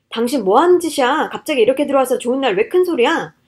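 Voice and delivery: loud, indignant husband; angry retort. Acted. Husband scolds wife for making a scene at the birthday, angry. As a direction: shout back at your wife, affronted. Husband shouts back in quarrel.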